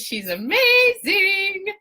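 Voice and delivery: high, loud, drawn-out gushing; gushing praise. Real, not acted. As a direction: stretch "amazing" out high and loud. Gushing praise of coffee bringer.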